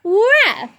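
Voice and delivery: put-on big high ruff for the kids; mom's playful woof; loud playful dog bark. Shown as a demonstration. Mom models dog bark loudly.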